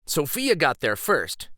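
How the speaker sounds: ordinary delivery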